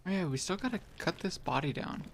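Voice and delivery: usual speaking voice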